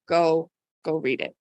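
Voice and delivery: normal talking voice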